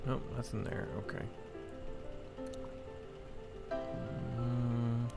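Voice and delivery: ordinary talk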